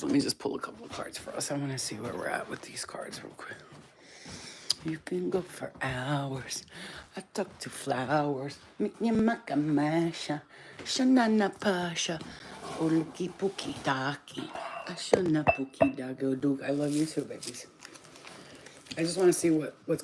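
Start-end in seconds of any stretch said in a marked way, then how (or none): 0.0-3.6 hushed, low, half-whispered; quiet aside. Real, not acted. Reader about to pull cards for viewers, quiet murmur. Absorbed while pulling cards. 4.9-17.7 soft cooing. Real, not acted. Owner murmuring to her babies, soft nonsense cooing.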